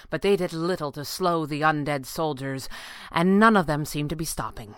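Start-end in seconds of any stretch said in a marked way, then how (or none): none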